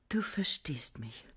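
soft slow breathy whisper; hushed and slow, just for him. Acted. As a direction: whisper it close to his ear. Intimate talk with imagined Gottfried.

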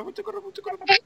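high panicky cry; panicked squeal. Real, not acted. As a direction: squeal in alarm while fleeing. Being chased by fast mob.

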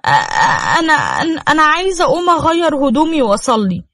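shy wife stammering to her husband. A narrator's reading. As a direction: stumble over the words, embarrassed.

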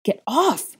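loud high roar. A narrator's reading. Boy roaring at the girl grabbing him, loud. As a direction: shove her off with a shout. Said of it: "he roared".

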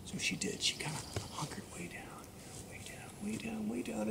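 very soft, sinking repetition; hushed build-up. A narrator's reading. Storyteller as the mouse hunkers before jumping, hushed suspense. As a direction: drop to near whisper as if crouching. Narrator mimics crouching before leap.

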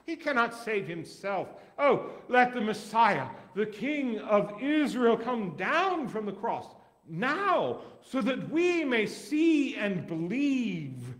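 high, slow sneering taunt; mock the so-called Messiah. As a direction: priests scoffing among themselves. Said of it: "were also mocking him".

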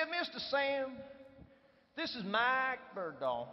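slow Southern US drawl; Southern accent. Retold or imitated. Executive introducing a visitor to the boss, in a Southern accent. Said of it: "with a beautiful Southern accent".

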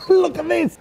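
high, loud excited exclamation; excited marvelling. Real, not acted. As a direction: show it off in high-pitched delight. Marvelling at old hand-made signs.

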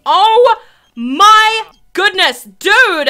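high, loud, drawn-out dismay; shocked exclamation. Real, not acted. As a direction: exclaim slowly in exasperated shock. Shocked reaction to game event.

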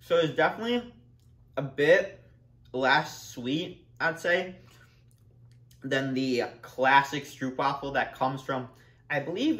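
speaking with food in mouth; words between chews; chewing while talking. Real, not acted. Seen 0: Bites the waffle at -1, jaw still working while speaking after.

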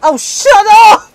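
annoyed yell. Real, not acted. Yelling at the creepy voice.

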